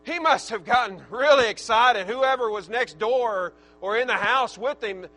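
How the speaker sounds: loud, high, impassioned preaching; preaching at full force. Acted. Pastor preaching to his congregation, shouting with fervor. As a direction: pour it out over the congregation.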